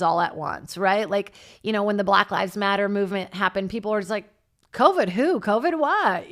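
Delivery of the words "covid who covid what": higher, louder sing-song questions; mock dismissive. Voicing people sick of the pandemic, mock dismissive. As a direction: toss it off as imitated careless crowd.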